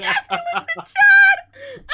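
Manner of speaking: high squealing laugh; laughing hysterically. Real, not acted. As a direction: crack up completely, words breaking. Cracking up at the meme.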